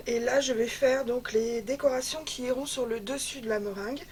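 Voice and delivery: ordinary delivery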